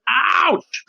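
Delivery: high, loud yelp of pain; sharp ouch; sudden pain. Shown as a demonstration. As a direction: cry out as if you just got hurt. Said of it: "It can mean you're hurt".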